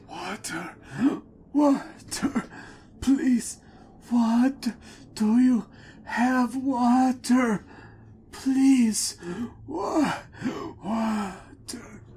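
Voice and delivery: high, crying cries for water; thirsty dwarves crowding strangers, crying and pleading; desperate pleading. Acted. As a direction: sob out the begging, voice breaking high. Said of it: "asking desperately for water".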